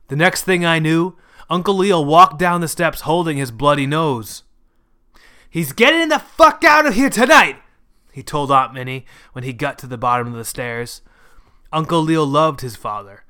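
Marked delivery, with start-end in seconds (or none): 5.5-7.6 high, loud, furious declaration; angry yell. A narrator's reading. Bloody-nosed son to his aunt, yelling angrily. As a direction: yell it out, at the end of your patience.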